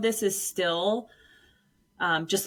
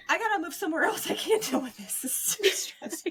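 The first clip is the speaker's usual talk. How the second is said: retold stressed outburst; 8 semitones higher than usual. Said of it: "I literally said out loud".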